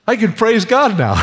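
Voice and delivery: high and loud, overflowing with praise; elated praise; joyful exclamation. Real, not acted. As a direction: proclaim it with real elation. Rejoicing over his recovery.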